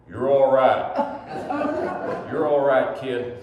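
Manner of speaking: a Stetson-wearing boss booming his approval; hearty cowboy boss. Retold or imitated. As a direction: clap him on the shoulder with a loud approving cowboy voice. Cowboy boss reassures the narrator.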